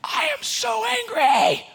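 high, slow mock rage yell; reenacted yell. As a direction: belt it like a frustrated dad at the steering wheel. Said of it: "I yelled at the top of my lungs".

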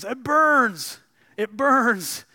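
high, loud scream of pain. Acted. Ghost man screams as the angel nears, pained scream. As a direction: scream like fire on your skin. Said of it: "And the man screams".

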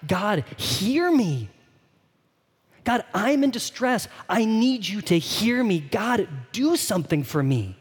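slow, drawn-out desperate plea; begging God. Acted. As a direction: beg with weight on each phrase. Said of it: "The psalmist is begging God".

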